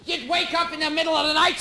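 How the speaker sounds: ordinary delivery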